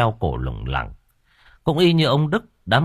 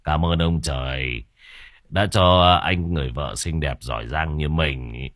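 scheming husband; 8 semitones lower, 3 dB quieter than usual. Acted. Bằng praises his wife for grabbing the land, low sly voice. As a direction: savour the windfall quietly. Bằng's voice.

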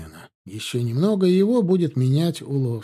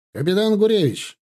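higher-pitched call to an officer; loud hail; 6 semitones higher than usual. A narrator's reading. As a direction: call out his rank and name. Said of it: "громко воскликнул один из всадников".

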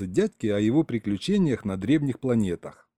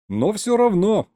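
loud, raised vow; defiant assurance; 10 semitones higher, 5 dB louder than usual. A narrator's reading. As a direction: assure the whole universe. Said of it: "заверил я Вселенную".

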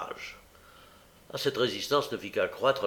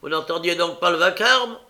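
angry; 7 semitones higher, 8 dB louder than usual. A narrator's reading.

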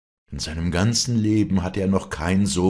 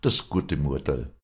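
deeply sad. A narrator's reading. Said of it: "sagte Josef tieftraurig".

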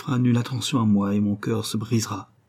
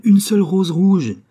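much higher, much louder cry; desperate cry; 9 semitones higher, 9 dB louder than usual. Acted. Nightingale begging for one red rose, loud desperate cry. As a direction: cry out with all your heart. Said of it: "cria le rossignol".

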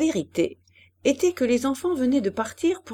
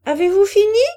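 high, loud impatient call; sulky girl's shout; 10 semitones higher, 9 dB louder than usual. A narrator's reading. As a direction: holler it across a garden, pitched way up. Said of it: "cria Pauline".

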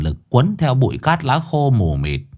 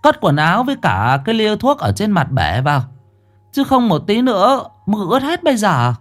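calling out; 10 semitones higher than usual. Acted. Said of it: "Bà Chiến nói to".

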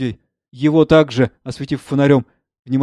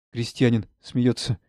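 normal talking voice

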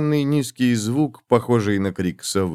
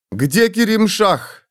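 out of breath, slow and high; breathless gasp; 10 semitones higher, much slower than usual. Acted. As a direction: gasp it out after running. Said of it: "тяжело выдохнула она".

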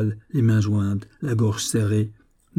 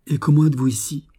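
mildly alarmed, raised pitch; troubled exclamation; 5 semitones higher than usual. A narrator's reading. Young woman questions the intruder, troubled exclamation. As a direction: demand it with a touch of alarm. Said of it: "s'écria-t-elle d'une voix altérée".

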